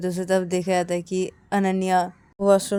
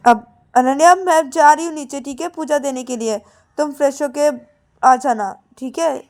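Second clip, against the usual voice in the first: somewhat high, louder calling through door; lightly voiced sister; 6 semitones higher, 9 dB louder than usual. Payal calls to Ananya in washroom, lightly raised. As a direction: call out to her in washroom.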